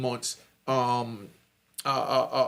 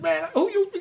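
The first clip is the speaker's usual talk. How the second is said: laughing retort; 12 semitones higher, 3 dB louder than usual. Retold or imitated. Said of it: "So now that got me to laugh".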